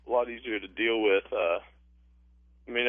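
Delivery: normal talking voice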